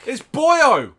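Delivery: loud, high Welsh call; mock Welsh bellow; Welsh shout. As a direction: belt the name out in a Welsh voice. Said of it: "Is he Welsh?".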